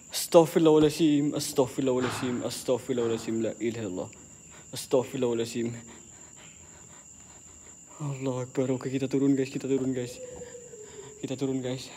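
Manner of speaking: scared low voice going downstairs; hushed prayers. Real, not acted. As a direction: mutter prayers to stay calm. Fading frightened prayers while descending.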